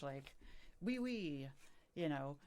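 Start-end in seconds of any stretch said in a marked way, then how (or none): 0.8-1.5 higher-pitched mock French; French gibberish. Shown as a demonstration. Imitating how they answer French tourists, in French gibberish. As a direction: put on playful fake French. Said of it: "sometimes I'll just speak, like, French gibberish".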